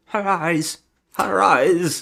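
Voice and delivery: high, hammed-up groaning of her eyes; mock the dying man's moan; imitating a dying man moaning. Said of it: "he keeps going".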